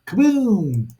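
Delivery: mock explosion. Sound effect for explosion.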